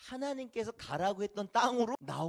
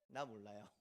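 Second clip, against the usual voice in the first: low, soft shrug-off; offhand dismissal; 10 semitones lower, 11 dB quieter than usual. Acted. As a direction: brush it off with feigned ignorance. Acting out believers who forget God. (시치미 떼는 말투)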